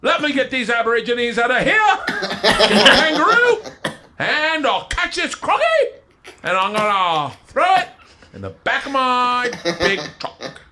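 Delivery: very high, drawn-out mock Australian accent. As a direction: ham up a broad Aussie accent. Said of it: "How about Australia?".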